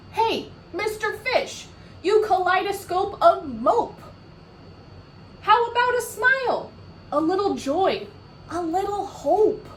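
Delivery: high, loud teasing squid voice; cheeky squid. Acted. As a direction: tease the sulky fish brightly.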